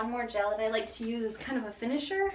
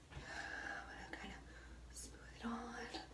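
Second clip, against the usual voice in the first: much softer hushed whisper; 16 dB quieter than usual. Real, not acted. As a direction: hush it like ASMR. Gentle styling demo.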